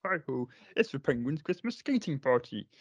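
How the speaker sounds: frog puppet impression; Kermit. Co-host performing the requested line for podcaster, Kermit voice. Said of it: "you can hear my other Kermit impression".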